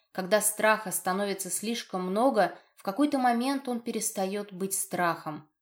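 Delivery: plain reading voice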